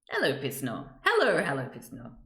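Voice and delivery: usual speaking voice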